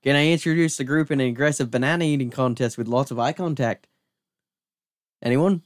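loud, excited Australian-ish voice; Australian guy. Acted. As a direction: announce it like a pushy party guy. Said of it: "immediately goes".

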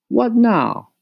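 a brusque mother wanting to know; mother asking what now, a bit impatient; Mama, impatient. Acted. Mama voiced in story.

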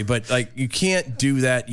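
ordinary talk, nothing marked

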